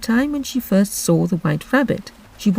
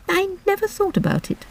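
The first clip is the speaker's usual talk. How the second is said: put-on high, soft girl's voice; 14 semitones higher, 4 dB quieter than usual. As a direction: speak like a polite little girl. Alice voiced in story.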